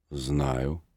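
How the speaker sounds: put-on a touch of a gruff older man; low gruff reply. A narrator's reading. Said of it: "продолжал высокий человек".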